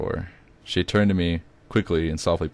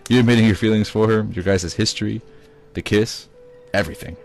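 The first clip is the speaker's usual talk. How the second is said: louder, higher voice for the mother; mother's voice; 4 semitones higher, 5 dB louder than usual. Acted. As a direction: voice the girlfriend's mother. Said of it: "she explained".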